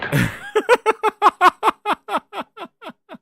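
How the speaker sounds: high loud burst of laughter. Real, not acted. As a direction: crack up for real. Big laugh at clip.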